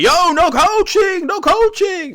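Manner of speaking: high, loud, drawn-out yell; mock crowd heckle. Spectators objecting to coaching at a money match, high mock yell. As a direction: call it out high and long like protesting spectators.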